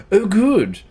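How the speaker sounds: high, loud burst of delight; sudden pleased outburst; real excitement. Real, not acted. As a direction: exclaim it right after a gasp. Pleased reaction after a sudden gasp.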